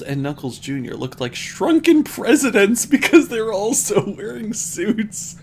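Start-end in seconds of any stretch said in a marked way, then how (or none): 1.4-5.4 high, loud giggling read; laughing. Real, not acted. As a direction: crack up through the line. Cracking up while reading.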